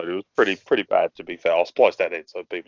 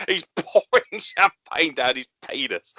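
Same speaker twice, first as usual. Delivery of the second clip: normal talking voice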